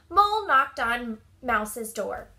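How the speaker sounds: plain reading voice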